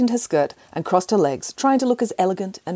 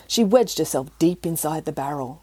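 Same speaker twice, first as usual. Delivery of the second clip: plain reading voice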